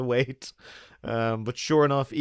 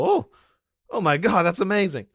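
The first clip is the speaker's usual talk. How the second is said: excited; 6 semitones higher, 4 dB louder than usual. Retold or imitated. Excited retold reaction.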